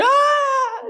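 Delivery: sudden high shriek; high scared scream. Retold or imitated. Son startled at his iPad, high shrieking scream. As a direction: scream like a startled little girl. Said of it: "just like a little girl".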